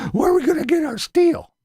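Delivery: high, loud mock wail about steel; mock panic. Ranter mocking critics, high mock whine. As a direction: whine it like a helpless fool.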